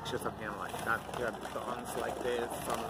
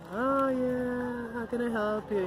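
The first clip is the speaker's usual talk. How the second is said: higher, sweet customer-service lilt; sugary clerk voice; 12 semitones higher, 4 dB louder, slower than usual. Retold or imitated. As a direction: play the eager shop clerk beaming at Becky. Clerks greeting Becky warmly.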